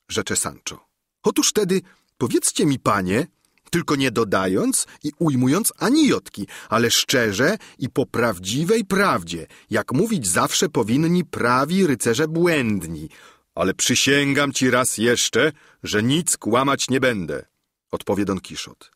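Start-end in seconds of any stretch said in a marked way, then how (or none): 1.2-13.1 a lightly voiced peasant squire, a touch higher; plain-spoken servant arguing with his master, lightly played; Sancho Pansa. A narrator's reading. Sancho explaining to his master, mildly raised and earnest. 13.5-17.4 the knight speaking gravely, lightly voiced; Don Kiszot. A narrator's reading. Knight answering his squire, lightly lowered and dignified.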